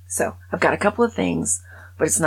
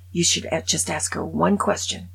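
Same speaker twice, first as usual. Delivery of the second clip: ordinary talk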